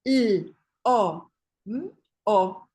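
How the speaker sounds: lobo said as separate sounds L, O, M, O. Shown as a demonstration. Therapist sounding out a word for the child, phoneme by phoneme. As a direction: sound out each letter of lobo apart. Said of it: "¿Qué palabra dice?".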